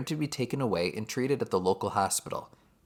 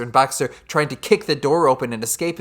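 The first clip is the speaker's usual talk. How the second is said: usual speaking voice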